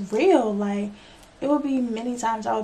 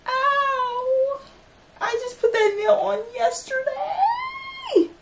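real pain shout; much slower than usual. Real, not acted. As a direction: cry out the hurt as it happens. Breaks her nail mid-talk.

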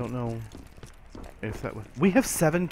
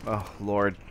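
ordinary talk

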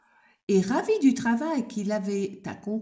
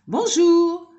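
cheerful greeting; 8 semitones higher, 7 dB louder than usual. Acted. Townsfolk greeting.

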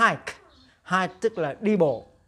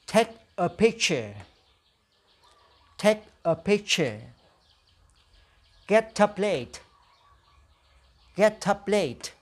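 slow, careful phrase for students to echo; slow model for repeating; slower than usual. Shown as a demonstration. Teacher drilling vocabulary for the class to repeat. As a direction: say each phrase slowly, twice, to be copied. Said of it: "Now repeat after me".

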